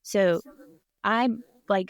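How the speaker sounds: ordinary talk, nothing marked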